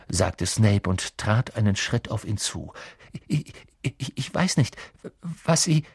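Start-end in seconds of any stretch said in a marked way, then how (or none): 3.3-5.8 nervous stammer, higher voice; stuttering. A narrator's reading. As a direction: stammer and break off, anxious. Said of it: "doch er stotterte schlimmer denn je".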